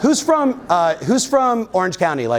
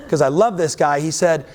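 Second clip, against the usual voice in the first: normal talking voice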